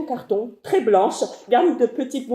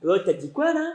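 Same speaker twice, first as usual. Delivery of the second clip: low creepy man; 6 semitones lower, 5 dB quieter, faster than usual. Acted. Man taunting from car window.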